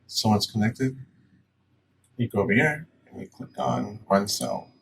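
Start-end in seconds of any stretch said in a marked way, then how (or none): none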